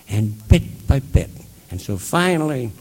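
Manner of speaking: normal talking voice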